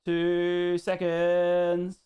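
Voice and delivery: very slow, stretched-out syllables; drawn-out stalling. Real, not acted. Streamer asks viewers to wait, drawn-out stalling. Stalling while finding the next post.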